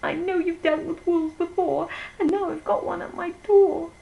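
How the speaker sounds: squealing pig. Acted. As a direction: cry it high like a frightened piglet.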